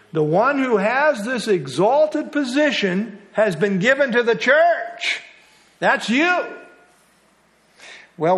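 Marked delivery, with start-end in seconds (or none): 0.1-6.5 preacher driving the point home to the class; emphatic rise. Real, not acted. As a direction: build it up and land on "That's you". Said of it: "The emphasis here".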